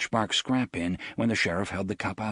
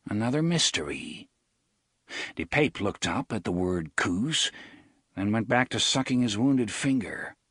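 usual speaking voice

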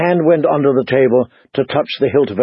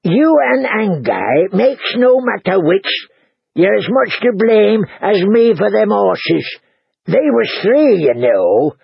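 higher, aggrieved innkeeper voice; complaining husband; 6 semitones higher, slower than usual. Acted. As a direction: grumble in self-defence.

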